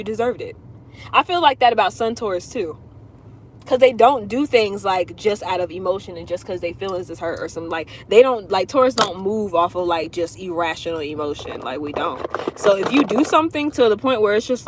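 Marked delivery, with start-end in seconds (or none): none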